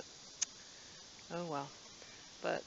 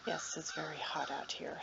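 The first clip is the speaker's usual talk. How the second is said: breathy whisper; worn-out whisper about the heat; 6 dB quieter than usual. Real, not acted. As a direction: whisper it while the heat wears you down.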